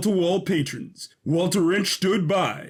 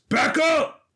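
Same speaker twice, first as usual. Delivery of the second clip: much higher alarmed yell; sudden warning shout; 11 semitones higher than usual. Acted. Sudden warning of falling danger.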